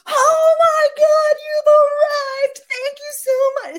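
hammed-up squeal greeting a dinner guest; too much. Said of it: "It's, like, too much".

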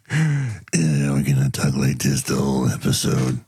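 low gruff cop growl; grizzled eighties cop voice. Pretend. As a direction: play a hard-boiled buddy cop. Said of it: "the grizzly cop of the eighties".

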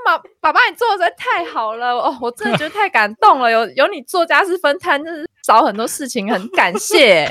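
a hint of over-the-top gratitude; mock gushing praise. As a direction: lay the praise on thick. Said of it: "你就跟他讲说".

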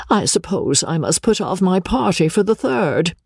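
high, put-upon lady character; Miss Crawley voiced. A narrator's reading. Miss Crawley speaks in the story.

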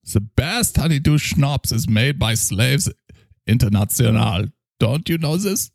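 put-on a drunk German doctor praising his schnapps; heavy German accent, tipsy. Character continues.

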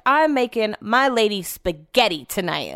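ordinary delivery

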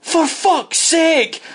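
high, slow, fed-up cry; exasperated outburst. Retold or imitated. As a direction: drag it out like a coach who has had enough. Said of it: "and Archie went".

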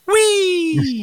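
high, loud drawn-out whee; whee shout. Acted. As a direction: squeal it down the slide. Third man shouting down slide.

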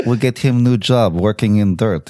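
menacing mock Russian mobster; hint darkly with a Russian accent. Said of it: "doing the Russian accent".

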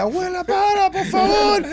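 high, loud mock yell; acted frantic plea. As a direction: beg grandma to stop over the commotion. Acting himself restraining his fighting grandma. (súplica gritada y aguda, exagerada)

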